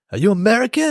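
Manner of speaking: high-pitched, eager stranger's question; imitated street hustler. Stranger on the street approaching tourists, high imitated eagerness.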